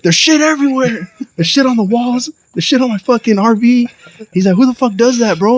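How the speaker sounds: high-pitched, exasperated complaint; mad neighbor. Retold or imitated. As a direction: imitate an indignant neighbor listing the damage. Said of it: "He was mad, but he was being cool".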